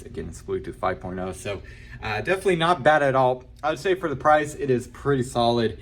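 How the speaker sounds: ordinary delivery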